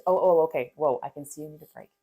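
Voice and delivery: put-on hushed soothing mom to an upset kid; calm reassuring parent. Shown as a demonstration. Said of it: "the language is".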